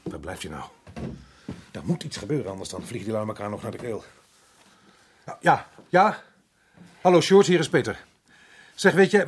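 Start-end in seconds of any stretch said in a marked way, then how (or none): 5.2-9.2 urgent phone call. Acted. Resident urgently phones Sjoerd.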